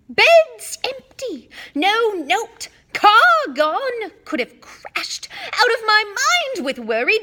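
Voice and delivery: shrieking, high and loud tirade; furious shouting. A narrator's reading. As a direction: explode at the guilty sons. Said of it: "her rage broke over them".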